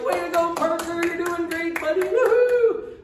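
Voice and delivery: high, loud sideline cheer, rising to a whoop; cheering for kids. Acted. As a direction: cheer like a proud fan at a little kid's game. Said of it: "we cheer for little kids".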